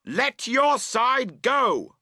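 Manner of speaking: high, loud, very slow shouted command; shouted order. Acted. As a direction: bark the order across the tent. Tent quarrel, shouting across.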